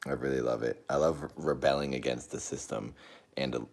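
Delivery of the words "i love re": ordinary talk, nothing marked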